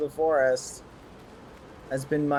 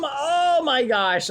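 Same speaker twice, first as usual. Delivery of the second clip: amazed shout; 9 semitones higher, 3 dB louder, slower than usual. Acted. Awed by own strength.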